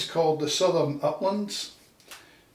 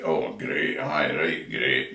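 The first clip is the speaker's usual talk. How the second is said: much lower gruff Scottish grumble; deadpan Scottish great; 12 semitones lower, slower than usual. Retold or imitated. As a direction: grumble great like a dour Scot. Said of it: "most common word in Scotland is great".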